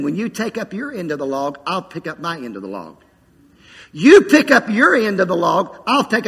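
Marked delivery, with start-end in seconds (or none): 0.0-3.0 Spirit's reply. Acted. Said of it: "And the Spirit is saying".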